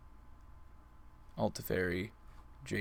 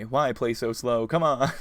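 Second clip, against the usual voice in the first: exasperated complaint; 3 semitones higher, 9 dB louder than usual. Real, not acted. Complaining at slow opponent.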